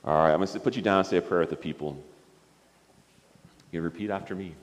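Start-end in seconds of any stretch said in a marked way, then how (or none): none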